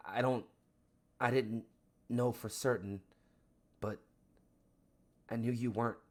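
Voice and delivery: understated tearful confession, halting; acted bawling. Daughter pressed into her father's chest, halting acted bawling. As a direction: stammer it out haltingly, as if crying into a chest. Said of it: "and just bawls".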